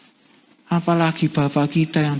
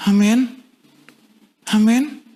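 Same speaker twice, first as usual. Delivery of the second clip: ordinary talk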